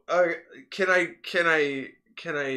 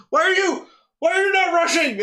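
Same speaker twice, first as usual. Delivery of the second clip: high, loud exasperated plea; wife demanding why he isn't rushing, frantic; 11 dB louder than usual. Retold or imitated. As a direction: hurry him up in a panic. Wife urging husband.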